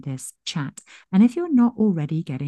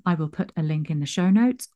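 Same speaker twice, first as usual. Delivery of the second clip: normal talking voice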